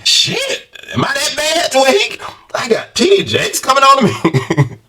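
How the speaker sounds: high, shocked squeak of disbelief; mock horror. Vlogger pretending to be hit on, shocked high voice. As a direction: act appalled at yourself. Imagined horrified self-question.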